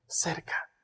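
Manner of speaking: unvoiced whisper. Shown as a demonstration.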